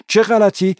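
angry retort. A narrator's reading. Narrator cut off mid-sentence.

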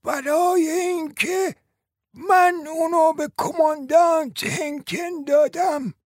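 put-on very high, drawn-out old lady's voice; frail-old-woman character, pitched far up; slow, clear old woman. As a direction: pronounce each word slowly and distinctly. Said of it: "آهسته و واضح".

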